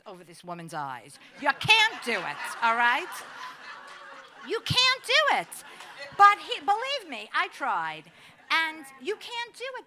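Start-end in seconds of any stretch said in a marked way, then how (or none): 1.4-9.8 loud and higher, laughing as she insists; laughing while telling the audience you can't fool her mother; laughing emphasis. Real, not acted. Speaker tells the audience her mother can't be fooled, laughing loudly.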